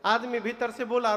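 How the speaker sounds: ordinary talk, nothing marked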